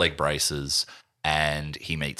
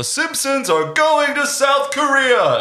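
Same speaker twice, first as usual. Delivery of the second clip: announcer voice; 7 dB louder, slower than usual. Acted. Acting a show's announcement.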